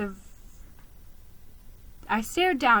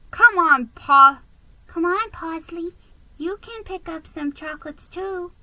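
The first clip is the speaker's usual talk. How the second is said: coaxing mother; 7 semitones higher, 5 dB louder than usual. Acted. Mother urging her child.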